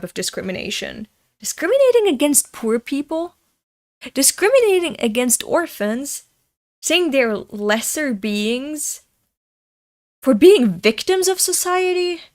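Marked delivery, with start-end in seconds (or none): 1.4-9.0 outraged rant. Real, not acted. 10.2-12.2 high, loud indignant cry; outraged peak. Real, not acted. Outraged rant climax.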